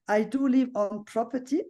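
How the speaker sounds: ordinary talk, nothing marked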